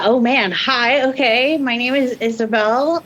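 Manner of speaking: normal talking voice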